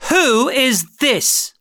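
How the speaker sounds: high, slow, stern demand; severe queen. Acted. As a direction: ask like a stern queen, very slowly. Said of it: "the Queen said severely".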